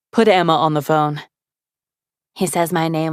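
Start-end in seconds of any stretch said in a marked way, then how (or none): 0.1-1.2 lightly voiced man, firm; a hint of a calm male voice giving an order; male voice on phone. A narrator's reading.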